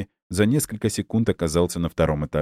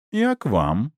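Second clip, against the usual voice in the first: purring seductress; 7 semitones higher, slower than usual. Acted. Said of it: "промурлыкал за дверью низкий женский голос".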